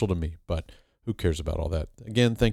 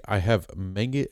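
normal talking voice

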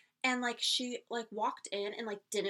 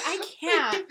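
laughing disbelief; 5 semitones higher, 8 dB louder than usual. Real, not acted. Laughing retelling her exasperation.

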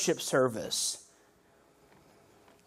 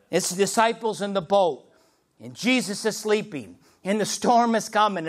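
ordinary talk, nothing marked

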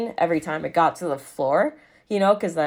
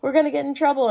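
mock scared friend; 11 semitones higher than usual. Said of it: "so scared".

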